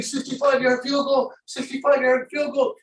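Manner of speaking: high, strained excited yell; retold screaming. Dad reliving the long field goal attempt, excited screaming. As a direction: yell it like a fan glued to the game. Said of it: "I start screaming".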